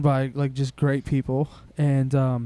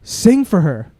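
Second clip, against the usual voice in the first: pushy demand; 3 semitones higher, 8 dB louder, slower than usual. Retold or imitated. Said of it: "and she goes".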